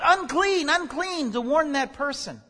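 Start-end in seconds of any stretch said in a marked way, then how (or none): 0.0-1.3 high, loud cry that fades on the second word; shouted warning. Acted. Leper warning anyone who comes near, a high shouted cry. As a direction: call out to keep people away. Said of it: "you had to shout".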